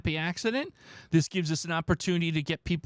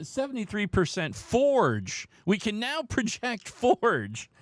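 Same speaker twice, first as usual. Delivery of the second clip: election analyst; 6 semitones higher, slower than usual. Pretend. Calling the poll like election night.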